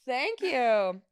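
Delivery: ordinary delivery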